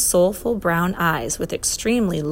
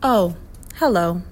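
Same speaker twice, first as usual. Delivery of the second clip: simply said. A narrator's reading. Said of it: "Then he simply said".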